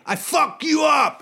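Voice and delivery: loud, high, drawn-out threatening shout; Tico impression. Podcast guest mimicking a scary drummer's threat, shouted. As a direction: bellow a slow threat as the drummer. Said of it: "He was like that".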